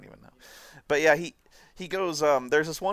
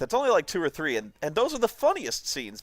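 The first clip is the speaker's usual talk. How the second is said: ordinary talk, nothing marked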